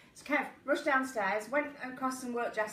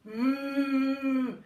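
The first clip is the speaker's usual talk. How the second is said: lightly voiced angry cow, a bit louder; angry cow moo; 4 dB louder than usual. Showing viewers her angry cow impression, lightly voiced moo. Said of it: "that's my cow impression".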